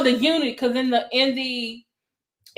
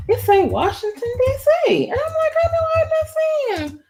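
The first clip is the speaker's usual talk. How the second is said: real excited disbelief, rising very high; high-pitched excitement; 13 semitones higher than usual. Real, not acted. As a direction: pitch climbing to a squeal. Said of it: "I get higher when I get excited".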